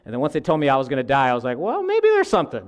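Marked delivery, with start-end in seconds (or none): 1.6-2.6 very high, squeaky backpedal; comic sudden doubt. Retold or imitated. Atheist told he is going to die, comic high-pitched backpedal. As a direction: shoot the pitch up as if hastily reconsidering.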